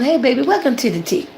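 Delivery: ordinary delivery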